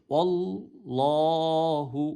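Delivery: ওয়াল্লাহু with lips rounded on ওয়া, drawn out slowly; slow demo of ওয়াল্লাহু, lips rounded on ওয়া. Shown as a demonstration. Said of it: "ঠোঁটটা গোল হবে".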